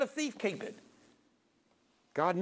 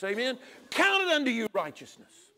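ordinary talk

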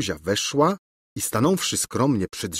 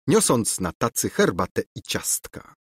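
ordinary delivery, nothing marked

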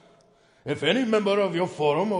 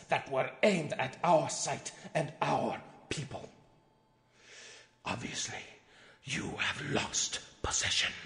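ominous hush; 5 semitones lower, 9 dB quieter than usual. Acted. Executive warning the forum members, in a hushed menacing voice. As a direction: lean in and threaten quietly.

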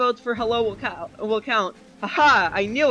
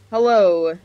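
loud greeting; 9 dB louder than usual. Real, not acted. Greeting loudly into mic.

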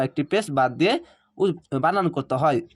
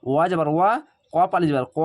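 ordinary delivery

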